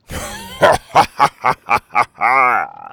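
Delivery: loud, staccato pirate cackle; villainous laugh. Acted. Captain with the egg in his pouch, villainous laughing. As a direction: gloat with a long villain laugh. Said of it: "The captain laughed".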